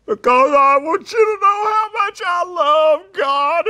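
high, loud, sobbing declaration; acting out a man bursting into tears; imitated tearful cry. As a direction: cry it out at the top of your voice, overcome. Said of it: "he just started crying".

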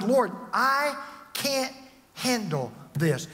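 slow, stretched plea to the Lord; desperate prayer. Retold or imitated. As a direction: cry out slowly, then collapse.

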